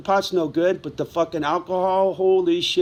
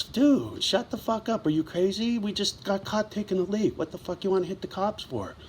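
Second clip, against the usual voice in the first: higher, softer urgent pleading; hushed disbelief; 4 semitones higher, 6 dB quieter than usual. Retold or imitated. Buddy talking him out of hitting cops, hushed disbelief. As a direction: quietly talk a friend out of it, pitched up.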